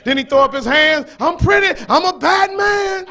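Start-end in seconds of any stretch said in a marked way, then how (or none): 1.2-3.1 imitating Ali's boast. Preacher imitating a boxer crowing to the crowd. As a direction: crow like the champ after the fight. Ali boasting after the knockout.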